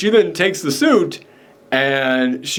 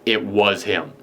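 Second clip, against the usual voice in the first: lower, insistent retold denial; 5 semitones lower, slower than usual. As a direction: imitate her firmly insisting. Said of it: "And she's saying".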